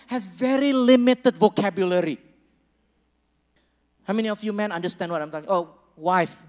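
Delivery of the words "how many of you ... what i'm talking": normal talking voice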